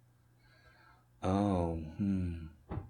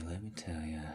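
breathy secret whisper; 2 semitones lower, 8 dB quieter than usual. Acted. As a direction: lean in and whisper it. Repeated line, whispered second take.

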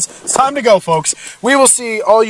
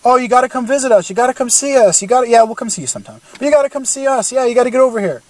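lightly higher, mimicking American friends; mock American; 5 semitones higher than usual. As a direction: mimic eager Americans inviting you. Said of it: "My American friends".

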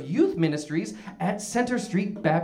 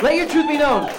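"truth" punched much higher and louder; rallying slogan; 8 semitones higher, 7 dB louder than usual. Acted. Host shouting his catchphrase at the audience, rallying. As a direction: belt the catchphrase to the crowd.

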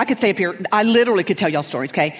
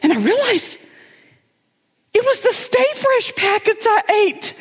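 much higher horrified voice with a laugh; higher mortified friend voice; high panicked friend; 4 dB louder than usual. Retold or imitated. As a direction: wail the discovery in a high pitch. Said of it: "She goes".

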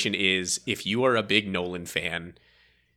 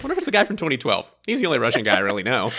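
musing inner thought; faster than usual. Retold or imitated. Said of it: "I was like".

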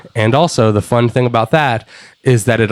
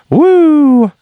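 high, loud drawn-out woo; Ric Flair woo; 6 dB louder than usual. Pretend. Imitating Ric Flair's trademark woo, loud and high. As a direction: belt out a signature wrestler's woo. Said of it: "comes back and gets in the ring and says".

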